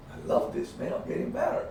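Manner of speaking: hushed, private self-talk; quiet pleased aside to himself; whispered inner thought. Retold or imitated. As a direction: whisper it to yourself mid-fight. Inner thought while rolling.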